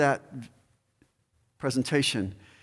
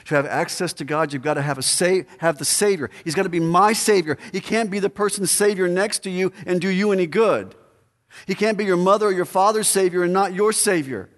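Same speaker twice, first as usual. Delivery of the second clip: rising, much louder and higher preacher's cry; full-force preaching; 7 semitones higher, 7 dB louder than usual. Acted. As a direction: build to a pulpit-pounding peak. Preaching climax builds loud.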